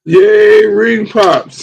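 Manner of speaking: loud, high cheer of joy; excited cheer. Real, not acted. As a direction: shout it out with glee. Echoing a cheer for Ring Pops.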